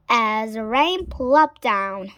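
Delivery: ordinary delivery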